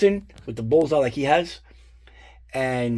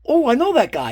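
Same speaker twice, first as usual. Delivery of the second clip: high, louder bright "oh" of recognition; lightly voiced wife, pitched up; wife's sudden recognition; 10 semitones higher, 5 dB louder than usual. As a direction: voice the wife realizing she knows him. Wife recognizes guy on screen.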